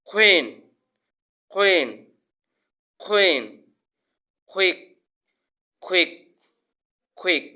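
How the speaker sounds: "কুইন", "কুইক" opening with khw; short loud drill, puff on the k of "কুইন"; aspirated Q. Shown as a demonstration. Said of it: "খ এর মতো উচ্চারণ".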